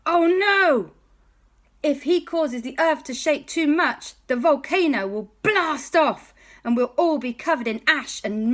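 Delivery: high, loud urgent hero voice; alarmed Superman. Acted. Clark Kent warns everyone the volcano will blow, high urgent voice. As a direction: raise the alarm like a panicky hero.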